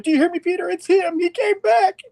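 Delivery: hammed-up alarm into the phone; mock panic. Wife warns her husband on the phone in a high mock panic.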